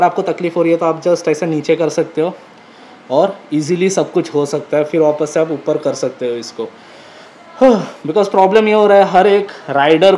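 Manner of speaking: muffled through face cloth; speaking inside a helmet. Real, not acted. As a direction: voice behind visor. Seen 2: Helmet on throughout; balaclava covers mouth until pulled down around 4.7.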